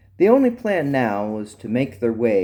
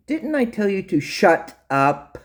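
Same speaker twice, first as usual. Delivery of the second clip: higher-pitched, indignant scolding; exasperated telling-off, pitched up; 8 semitones higher than usual. Acted. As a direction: scold the prisoner with sharp questions. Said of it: "she scolded him".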